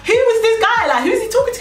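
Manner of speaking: high-pitched, incredulous questioning; mock-bewildered, voice shooting high; incredulous inner voice. Inner reaction to a stranger approaching.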